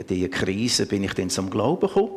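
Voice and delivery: normal talking voice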